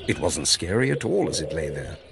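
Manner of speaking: ordinary delivery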